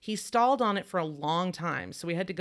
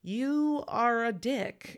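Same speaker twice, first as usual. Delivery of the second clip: very slow, higher, spelled out; deadpan insult; 7 semitones higher, much slower than usual. Retold or imitated. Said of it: "she's like".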